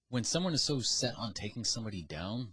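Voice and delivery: ordinary talk, nothing marked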